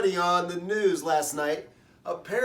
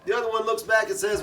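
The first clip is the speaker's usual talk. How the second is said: ordinary talk, nothing marked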